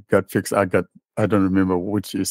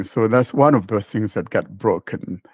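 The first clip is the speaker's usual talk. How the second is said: ordinary talk, nothing marked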